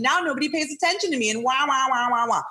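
whiny baby wah-wah, mocking. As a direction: wail "wah, wah, wah" like a crying toddler. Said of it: "they'll complain about".

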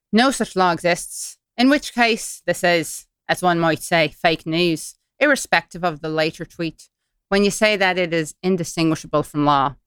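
put-on Irish accent, reading formally. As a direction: read the email in an Irish lilt. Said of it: "doing this in an Irish accent".

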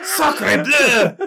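high, loud mock French exclamation; French accent. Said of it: "That's my French".

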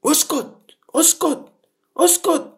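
the crowd snapping at him to shut up; harsh high hush, building. Acted. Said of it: "فالناس زجروه".

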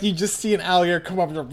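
ordinary talk

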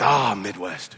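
low, lightly Scottish echo of the reply; Scottish accent. Retold or imitated. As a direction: play the Scottish neighbor catching on. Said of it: "with her thick Scottish accent".

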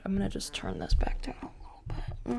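soft unvoiced whisper. Real, not acted. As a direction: drop to a whisper. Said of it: "I feel like I'm so loud".

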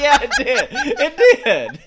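laughing while talking; words breaking into laughter. Real, not acted. Group laughing at spooky coincidence.

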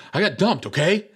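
high, defensive retort; mock aggressive. Dumped friend snaps at second teasing roommate, mock aggressive. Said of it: "he's now more aggressive".

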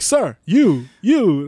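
loud, high mock professor call; single out the culprit, high and loud. Professor to student lost in texting, mock loud call-out. Professor calls out oblivious texter.

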